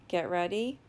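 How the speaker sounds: normal talking voice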